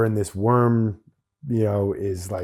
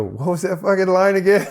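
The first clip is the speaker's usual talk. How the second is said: laughing question; 7 semitones higher, 3 dB louder than usual. Retold or imitated. Embarrassed extra asks the director for his line, laughing. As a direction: laugh through a higher, louder question.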